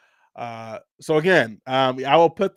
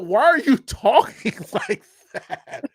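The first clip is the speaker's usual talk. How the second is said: higher-pitched laughing protest; amused, pitched-up retold complaint; laughing disbelief; 9 semitones higher, 4 dB louder than usual. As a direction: ask it incredulously through a laugh. Retold incredulous reaction to accent.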